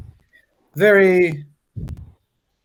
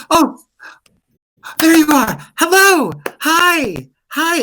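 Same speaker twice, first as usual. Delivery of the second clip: much higher, louder, squealing hellos; giddy alchemist; 14 semitones higher, 5 dB louder, slower than usual. Acted. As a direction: gush over him like a smitten fan. GM voices giddy alchemist greeting.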